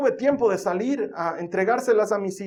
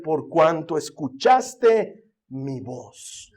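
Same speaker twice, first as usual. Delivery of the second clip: very slow, lower, solemn divine voice; voice of God; 3 semitones lower, much slower than usual. Acted. God speaking to the faithful listener, slow and solemn. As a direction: intone it like God pronouncing a blessing.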